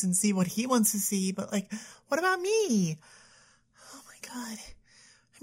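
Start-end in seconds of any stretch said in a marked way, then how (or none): 3.9-5.4 breathy whispered disbelief. Acted. Girlfriend whispering to her therapist about her boyfriend, exasperated. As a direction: hiss it under your breath, exasperated.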